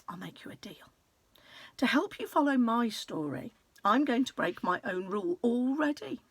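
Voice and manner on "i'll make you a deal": hushed, secretive aside to the reader; conspiratorial whisper. A narrator's reading. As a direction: whisper it like a secret only we share. Offering a secret deal.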